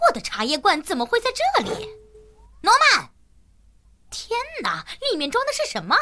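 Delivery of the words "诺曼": sharp call across the house. Acted. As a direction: yell the boy's name. Mother calling her son.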